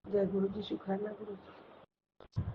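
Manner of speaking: breath-only prayer; whispered thanks. Real, not acted.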